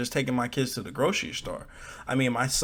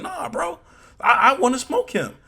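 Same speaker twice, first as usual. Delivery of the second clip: mock outraged dad; 10 semitones higher, 5 dB louder than usual. Imagined protective dad reaction.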